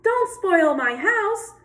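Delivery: yelling rabbit. Acted. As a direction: cry out high to stop the leopard. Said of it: "Rabbit yelled".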